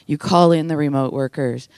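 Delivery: ordinary talk, nothing marked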